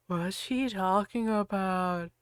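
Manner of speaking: soft, slow slurred mumble. Acted. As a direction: slur it out groggily. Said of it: "Lupin slurred".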